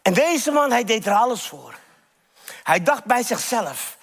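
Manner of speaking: ordinary delivery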